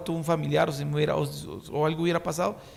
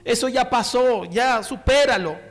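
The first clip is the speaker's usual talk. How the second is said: slow, raised, louder get-over-it voice; imitating an impatient comforter; pushy dismissal; 6 semitones higher, 6 dB louder, slower than usual. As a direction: press them to get over it, mocking. Mocking insensitive consolers.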